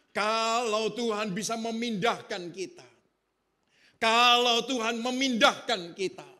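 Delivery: rising to a loud, slow, high preaching climax; full-force preaching. Acted. Pastor to his congregation about being planted, preaching at full force. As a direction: pound out each phrase to the congregation.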